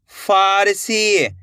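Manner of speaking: फारसी very slowly, सी held long. Shown as a demonstration. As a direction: stretch फा and सी in फारसी. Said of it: "मेरे साथ साथ इनको repeat करना है".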